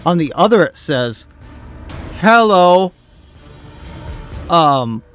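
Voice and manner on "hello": ordinary talk, nothing marked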